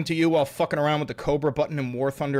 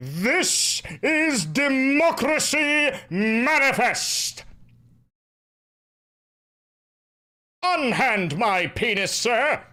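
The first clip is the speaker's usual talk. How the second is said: high, slow, booming declamation; hammed-up outraged thespian under arrest; theatrical indignant orator; 6 semitones higher, much slower than usual. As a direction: protest grandly, stretching every word. Same arrest speech impression.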